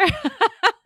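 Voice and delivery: a burst of high, loud laughter; amused laugh. Real, not acted. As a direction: laugh out loud at your own idea. Laughs at own question.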